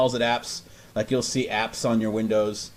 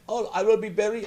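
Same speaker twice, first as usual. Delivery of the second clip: high put-on voice; mock scripted agent; 10 semitones higher than usual. Mocking the agent's scripted line, high put-on voice. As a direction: parody a polite script. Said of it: "they always have these scripted shit like".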